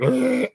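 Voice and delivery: a high, strained mock groan; mock leper groan. Streamer mimics the leper from It staring at him, high mock groan. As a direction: imitate the creepy clown's grunt. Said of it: "was like".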